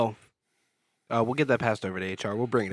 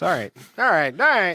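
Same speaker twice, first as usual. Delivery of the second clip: building exasperated all right; 5 semitones higher, 9 dB louder than usual. Real, not acted.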